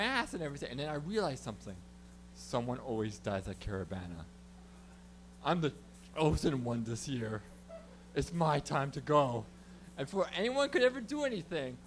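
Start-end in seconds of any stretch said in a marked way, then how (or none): 2.3-9.4 mock-solemn. Comic realizes he is this year's chosen one, mock-solemn. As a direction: intone it gravely, with weighty pauses.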